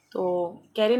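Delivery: ordinary talk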